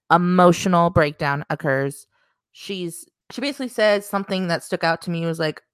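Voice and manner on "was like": normal talking voice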